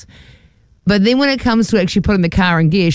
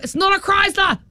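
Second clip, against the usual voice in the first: indignant; 12 semitones higher, 4 dB quieter than usual. Retold or imitated. Friend insisting to trolley guy.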